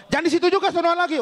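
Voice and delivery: high, lightly acted scolding; mock officer's order. Policeman moving noisy friends along, mock ordering. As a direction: shoo them further along.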